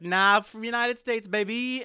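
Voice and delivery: high, slow, drawn-out brag; cheeky boast. Acted. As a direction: crow it triumphantly, stretching the words. Retold cheeky American reveal.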